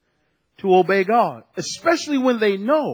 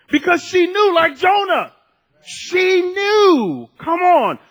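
high, raised preacher's cry; fired-up preaching; 9 semitones higher, 4 dB louder, slower than usual. Real, not acted. Preacher exhorts the congregation about her knowing, fired up. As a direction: drive the point home to the congregation. Preacher drives the point home.

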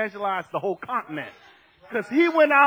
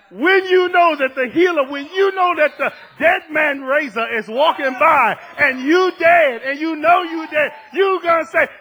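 loud, raised, driving sermon delivery; full-force preaching; 6 semitones higher, 9 dB louder than usual. Real, not acted. Preacher urging the congregation to cry out, full-force preaching. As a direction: build momentum with pounding emphasis.

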